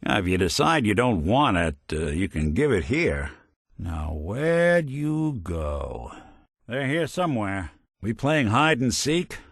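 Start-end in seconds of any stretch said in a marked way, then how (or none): 3.8-6.1 very slow, drawn-out question; slow searching call. Acted. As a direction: stretch each word while hunting someone. Searching for a hidden enemy.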